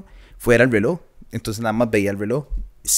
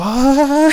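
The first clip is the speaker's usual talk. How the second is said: shocked gasp; 13 semitones higher, 7 dB louder than usual. Retold or imitated. Seeing his cut-open hand.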